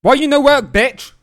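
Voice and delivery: very high, loud angry shout; frustrated yell. Real, not acted. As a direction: scream at the machine in frustration. Yelling at non-working board.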